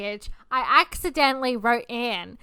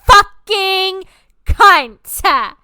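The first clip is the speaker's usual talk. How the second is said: slow, loud, higher, dragged-out swearing; retold furious outburst; furious insult; 6 semitones higher, 5 dB louder, much slower than usual. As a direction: spit each word out in rage. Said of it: "And I'm like".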